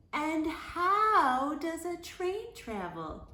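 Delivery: high, slow sly taunt; high teasing classmate voice; teasing rodents. A narrator's reading. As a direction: lay the trap with a wink. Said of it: "They winked at each other".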